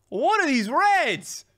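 high, slow, loud outraged question; shocked high-pitched what; stunned disbelief. Real, not acted. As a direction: drag out the disbelief. Shocked at opponent's cards.

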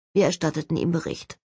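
ordinary delivery

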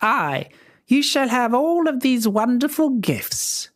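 high, sugary crone voice; fairy pretends kindness to the princess; wicked old fairy. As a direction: coo falsely over the baby. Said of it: "she said to the baby".

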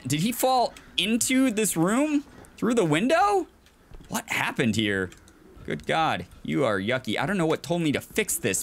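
usual speaking voice

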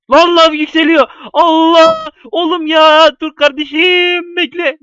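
high, loud near-crying whine; panicked whine. Real, not acted. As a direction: wail as the lava rises. Lava rising, cry heard.

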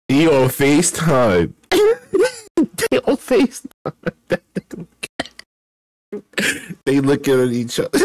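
high, helpless laughing through the words; cracking up. Real, not acted. Streamer seeing the couple on FaceTime, cracking up. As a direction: barely get it out through high-pitched laughter.

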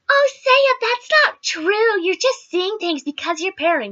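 patronise the paranoid storyteller; mock dismissive.

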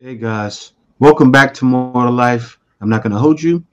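normal talking voice

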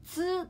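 つ said high and sharp; demo of つ. Shown as a demonstration. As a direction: pop out the sound つ clearly and high. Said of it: "難しいっていう日本語の音ってさ". (「つ」を高く強調して)

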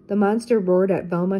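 ordinary delivery

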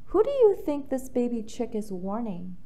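usual speaking voice

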